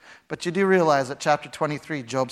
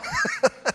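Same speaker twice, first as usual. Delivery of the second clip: high squeal of laughter; 4 dB quieter than usual. Real, not acted. Laughing at God's sarcasm.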